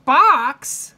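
high, very loud burst of disbelief; one loud, incredulous yelp; shocked exclamation. A narrator's reading. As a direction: blurt it out aghast. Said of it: "exclaimed Missus Rogers".

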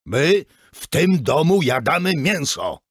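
loud, high angry shout. Acted. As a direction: yell it at the boy across the table. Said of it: "krzyknąłem". (ojciec krzyczy na syna przy stole)

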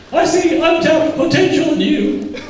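very high, cartoonish pep-talk voice; unconvincing motivational speaker. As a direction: squeak out some fake encouragement. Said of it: "Wasn't convincing, was he?".

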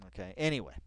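normal talking voice

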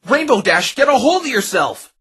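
high, loud sharp call to snap out of it; firm shout. Acted. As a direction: shake her out of it with your voice. Phoenix shouting her to calm down.

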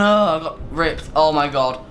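usual speaking voice

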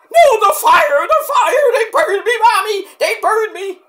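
hammed-up shrieking panic, very high; Cactus. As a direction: squeal in mock terror of burning. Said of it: "I'm gonna burn you!".

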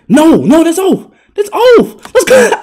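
high, loud hype; loud, high-pitched jubilation; excited shouting. Real, not acted. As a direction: yell it as the shot misses. Celebrating opponent's missed shot.

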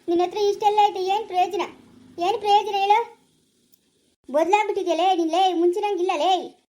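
heated, forceful reproach; scolding. Acted. Relative scolding a woman who has changed, angry. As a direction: tell her off with pointed questions. Acted scolding in a family quarrel.

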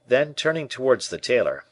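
ordinary delivery

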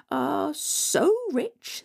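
high, slow, sly sweetness; scheming stepmother. Acted. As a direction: savour the cruel trick.

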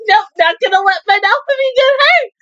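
high crying imitation of her refusal; mock tearful character line; quoting the character. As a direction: squeal it out high, half crying. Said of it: "she was like".

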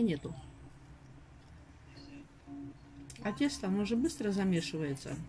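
talking while chewing food; words muffled by food; mouth full, chewing. Real, not acted. Seen 1: Bit a pastry at -3; cheeks still full while speaking.

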